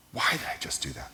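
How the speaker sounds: whispered to yourself, almost no voice; self-reproach whispered under the breath; inner whisper. Retold or imitated. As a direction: hush it like a private thought. Inner voice of regret, quoted.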